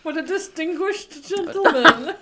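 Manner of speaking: laughing teasing remark; amused, giggling delivery. Real, not acted.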